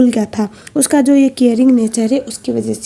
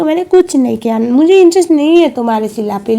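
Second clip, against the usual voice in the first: louder, raised retold denial; indignant protest; 3 semitones higher, 7 dB louder than usual. Min defending herself against accusations over Sheela, indignant protest. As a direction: protest your innocence loudly, as a storyteller.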